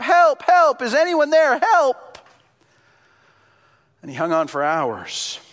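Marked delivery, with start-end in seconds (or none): 0.0-2.2 high, loud cry for rescue; screaming for help. Acted. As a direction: scream up from a cliff, desperate. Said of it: "he's screaming up".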